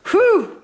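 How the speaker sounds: loud high exhausted whew; tired whew. A narrator's reading. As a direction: puff it out, worn out. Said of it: "Mater coughed".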